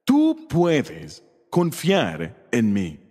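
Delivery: a slow, gentle promise to the congregation; tender reassurance. Retold or imitated. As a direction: let each word land gently, slowly. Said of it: "Solo escucha a Dios diciéndote".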